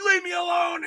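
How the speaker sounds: high yelled brush-off; retold yell. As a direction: yell back to be left alone. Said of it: "And he's yelling".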